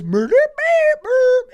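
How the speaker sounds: squeaky, slow, very high creature beeps; Grimace voice. Acted. Grimace announcing murder in a slow, very high squeak. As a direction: drag each beep out way up high. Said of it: "Grimace goes".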